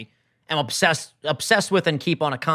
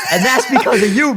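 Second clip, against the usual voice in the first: laughing excited; 7 semitones higher, 7 dB louder than usual. Real, not acted. Playful repeat to Phil.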